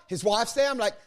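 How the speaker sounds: ordinary talk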